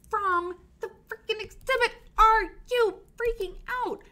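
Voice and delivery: high, loud excited squeal; bursting with the big news; squealing with excitement over the exhibit; thrilled squeal. Real, not acted. Excited announcement to viewers.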